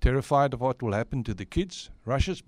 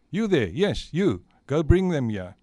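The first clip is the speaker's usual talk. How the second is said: loud, higher royal order; commanding; 3 semitones higher, 9 dB louder than usual. Acted. Santa sending someone for helpers, loud command. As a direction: call out an order to a servant.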